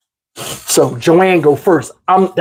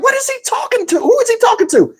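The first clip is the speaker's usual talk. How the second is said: very high, incredulous whine; high-pitched puzzled impression; baffled high voice. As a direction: ask it in a squeaky mock-bewildered voice. Mimicking a baffled listener in the basement.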